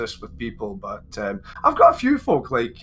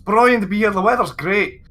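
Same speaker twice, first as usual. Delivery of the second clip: loud, high Scottish gush; excited Scottish newcomer; broad Scottish; 9 semitones higher, 6 dB louder than usual. Retold or imitated. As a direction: gush in broad Scots to baffled foreigners. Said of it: "we just don't know what kind".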